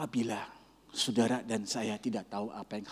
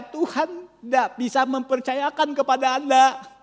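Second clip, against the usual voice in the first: loud, higher, passionate sermon delivery; fiery preaching; 13 dB louder than usual. Acted. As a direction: preach it at full force to the congregation. Pastor preaching at full force.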